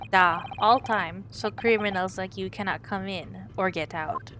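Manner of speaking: proud Russian sailor introducing himself. Acted.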